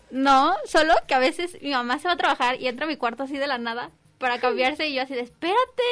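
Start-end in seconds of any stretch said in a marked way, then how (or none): 5.2-5.8 high, sudden startled cry of wait; flustered teen caught changing; startled yelp. Retold or imitated. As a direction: jump and blurt it out high. Startled teen stopping her mom.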